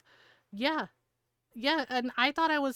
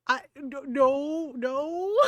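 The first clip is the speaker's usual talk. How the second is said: retold sheepish denial; 3 semitones higher, much slower than usual. Brother scolded by his sisters and mom, sheepishly backing down. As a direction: imitate a cornered brother protesting, voice climbing.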